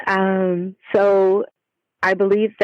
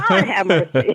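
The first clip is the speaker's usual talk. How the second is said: laughing. Real, not acted. Guest laughs at host's voice.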